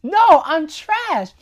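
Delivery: an overplayed little utensil insisting it is garbage; dramatic Forky. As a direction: ham up Forky's gloom for the laugh. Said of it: "He's like".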